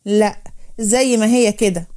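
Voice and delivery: ordinary delivery, nothing marked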